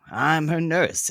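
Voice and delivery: ordinary delivery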